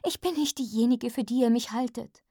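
high, urgent pleading; desperate. A narrator's reading. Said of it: "flüsterte ich verzweifelt".

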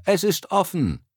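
high call from inside. A narrator's reading. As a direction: call out high to the visitor at the door. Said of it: "hörte er von drinnen jemanden rufen".

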